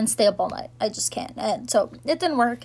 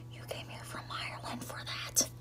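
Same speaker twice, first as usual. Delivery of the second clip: breathy disbelieving whisper, much softer; whispered aside; 19 dB quieter than usual. Retold or imitated. Disbelieving aside about the Irish.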